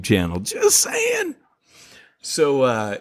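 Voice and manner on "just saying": very high-pitched tease; jokey squeal after a shameless plug; playful aside. Pretend. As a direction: pitch it way up, mock innocent. Joking plug of own channel.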